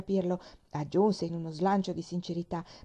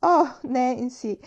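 very slow, loud, higher delighted cry; joyful cry; 5 semitones higher, 8 dB louder, much slower than usual. A narrator's reading. Pollyanna hugging Nancy, crying out with joy. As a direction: call out her name in pure joy. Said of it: "gridò Pollyanna".

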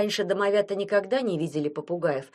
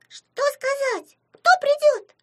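very high, squealed questions; bursting with curiosity; 6 dB louder, slower than usual. A narrator's reading. House imps begging to know who's coming, excited squeals. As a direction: squeak it out, louder on the second question. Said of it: "Запрыгали от любопытства домовята".

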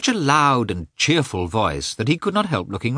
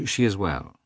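frowning reply; 3 dB quieter than usual. Acted. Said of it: "replied Karenin, frowning".

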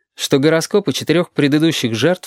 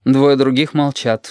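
ordinary delivery, nothing marked

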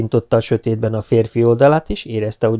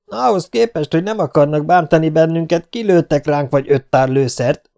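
loud, raised-pitch accusing retort; shouting out; 6 semitones higher, 4 dB louder than usual. Acted. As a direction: yell it out to the besiegers. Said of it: "aztán kikiabált". (kikiabálva, számonkérően)

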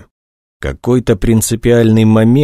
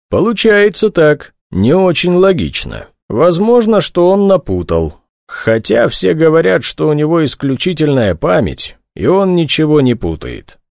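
lightly voiced colonel, pitched up; a hint of an official conceding doubt, higher; colonel reasoning aloud; 8 semitones higher than usual. A narrator's reading. Colonel answers investigator's doubts, reasoning in raised pitch.

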